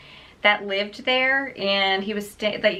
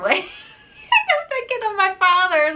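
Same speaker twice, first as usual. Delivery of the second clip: high, laughing through the line; 13 semitones higher than usual. Real, not acted. Picturing dad in Ace Ventura, real laughing. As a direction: burst into a giggle mid-sentence.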